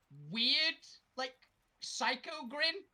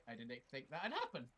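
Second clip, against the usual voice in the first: ordinary delivery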